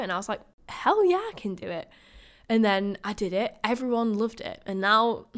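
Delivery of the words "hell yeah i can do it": pumped-up reply. Retold or imitated. Replying to boyfriend's encouragement.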